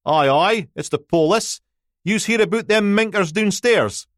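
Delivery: put-on old Aberdonian man, high and loud; thick Aberdeen brogue, calling out from the door; broad Aberdonian accent. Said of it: "the kind of Aberdonian accent you could plough fields with".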